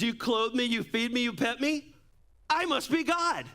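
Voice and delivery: high and lofty, climbing to a haughty "I must be God"; smug cat voice. Retold or imitated. Pastor voicing the cat to his church, high and haughty. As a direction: play the cat full of itself. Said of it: "And the cat says".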